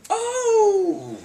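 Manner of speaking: mock an ecstatic whoop; excited shout. [name] hearing the true love line, laughing high whoop. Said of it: "he's bugging out again".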